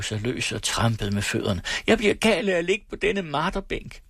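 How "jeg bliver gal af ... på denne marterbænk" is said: high, wild complaint; frantic outburst. A narrator's reading. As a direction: rage against the bed. Patient tearing herself loose, stamping. (ophidset udbrud)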